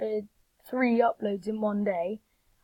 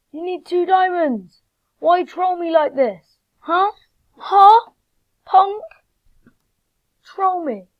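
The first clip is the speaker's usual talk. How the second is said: high, raised, indignant whining; real mock outrage, pitched up; exasperated complaint; 6 semitones higher, 9 dB louder than usual. Real, not acted. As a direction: protest at the game. Complains at the game.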